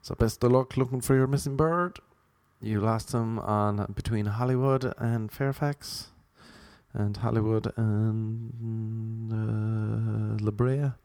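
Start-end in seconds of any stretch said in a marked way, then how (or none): 2.6-10.9 slow mock-sympathetic. Real, not acted. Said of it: "mock sympathy to owner".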